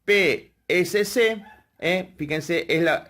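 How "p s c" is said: P S C spelled slowly, higher and stressed; emphatic slow spelling. Shown as a demonstration. Teacher stressing P S C while reading the manual. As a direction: stress each letter of P S C. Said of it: "Y donde dice".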